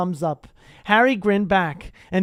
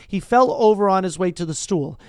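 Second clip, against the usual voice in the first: usual speaking voice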